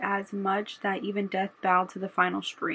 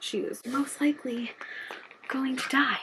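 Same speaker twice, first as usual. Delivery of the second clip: choking up; 5 semitones higher than usual. Real, not acted. Tearful telling of heroine's likely death.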